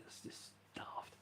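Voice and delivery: whispered slow aside; slow whispered self-judgment. Real, not acted. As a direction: breathe it out under the breath. Muttered aside to himself.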